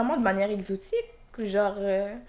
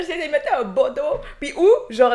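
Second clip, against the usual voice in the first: ordinary delivery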